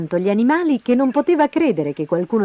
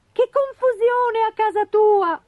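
high, slow bird voice; little wagtail. Acted. (uccellino con voce acuta)

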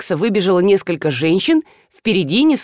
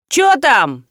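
higher, curious call; nosy question from the corridor; голос из коридора; 5 semitones higher than usual. A narrator's reading. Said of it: "Потом раздался вопрос". (любопытный оклик из коридора)

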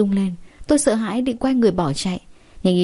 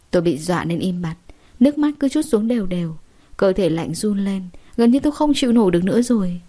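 plain reading voice

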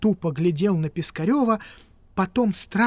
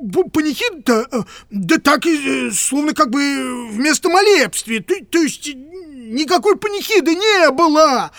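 high, loud, tangled stammering excuses; flustered stammer; 8 semitones higher, 8 dB louder than usual. Acted. Piskarev stammering as he backtracks on the requiem. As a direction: caught out, fumbling every word.

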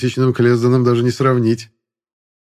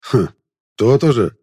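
commanding grunt. Acted. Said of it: "повелительно хмыкнул наёмник".

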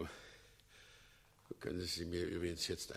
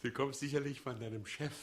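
normal talking voice

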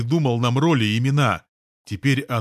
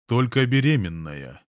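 ordinary delivery

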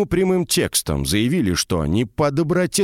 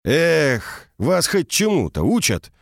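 higher-pitched mock outrage; sigh, then act indignant in a raised pitch; feigned indignation; 6 semitones higher, slower than usual. A narrator's reading. Ancient mage scolds poorly taught mages, feigned indignation. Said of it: "наигранно возмутился Галерон".

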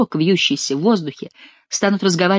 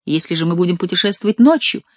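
plain reading voice

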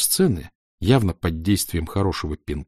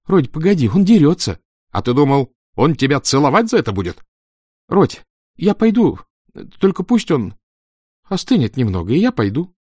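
put-on scared pleading boy; 7 semitones higher, 5 dB louder than usual. A narrator's reading.

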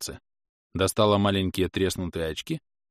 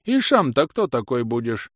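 put-on questioning old rustic with a lisp; higher-pitched, lisping old man's query; old villager; 6 semitones higher, 3 dB louder, slower than usual. Old man challenging a stranger, rustic lisping voice.

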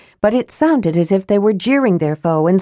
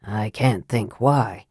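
low, slow, soft sarcasm; caustic; 8 semitones lower, 6 dB quieter, slower than usual. A narrator's reading. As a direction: draw it out with quiet scorn. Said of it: "Raistlin returned caustically".

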